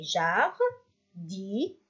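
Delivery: "Jardinier" slowly as jar, di, nier; slow "Jardinier", each syllable standing alone; split into syllables. Shown as a demonstration. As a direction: sound out "Jardinier" with pauses to count. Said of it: "On a combien?".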